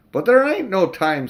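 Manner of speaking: cabby pleading there's no time; urgent cockney cabby. Acted. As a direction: rush to warn him.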